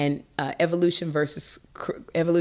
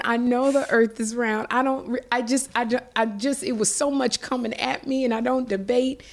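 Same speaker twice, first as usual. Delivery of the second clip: high-pitched, stumbling self-defense; mock-flustered insistence with false starts; flustered protest; 7 semitones higher than usual. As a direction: replay yourself pleading your case, voice pushed up. Retelling her repeated flustered self-defense.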